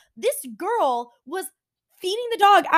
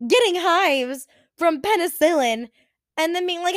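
normal talking voice

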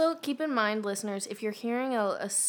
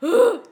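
retold gasp; 10 semitones higher, 11 dB louder than usual. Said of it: "you were like".

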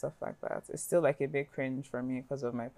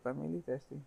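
while sipping a drink; 7 dB quieter, faster than usual. Real, not acted. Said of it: "Excuse me while I drink from her sippy cup".

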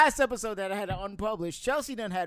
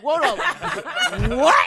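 louder startled what, laughing in between; startled Tom; 7 semitones higher, 11 dB louder, slower than usual. Retold or imitated. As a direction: snap your head round, caught off guard. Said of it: "Tom whipped his head around like".

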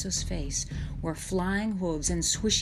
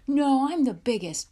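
put-on loud, higher boyish objection; insistent protest; 8 semitones higher, 9 dB louder than usual. A narrator's reading. Pierre shoves in for the first ride, insistent. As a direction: push in loudly to claim your place. Pierre argues, pushing Louis back.

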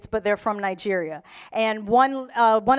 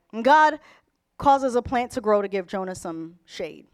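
ordinary delivery

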